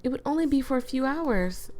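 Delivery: put-on high pleading girl voice; lightly voiced Emiko. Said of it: "Emiko voiced".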